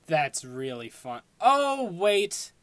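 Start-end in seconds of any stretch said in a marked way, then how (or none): 1.4-2.5 surprised. Real, not acted. Suddenly remembering.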